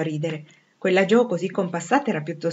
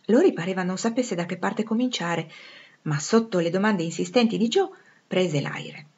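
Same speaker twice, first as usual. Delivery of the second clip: usual speaking voice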